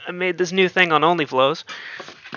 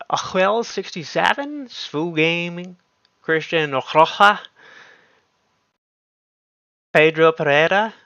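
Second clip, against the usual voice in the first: put-on Latin accent; 3 semitones higher than usual. Streamer reading donor names aloud, in a hammed-up Latin accent. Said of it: "I think that was a pretty good accent".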